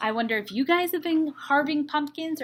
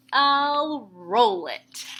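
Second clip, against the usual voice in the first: I'll roll it, drawn out slowly; slow proud plan; 4 dB louder, much slower than usual. Acted. Splat announcing his plan to Seymour, slow and proud. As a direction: savor the clever idea.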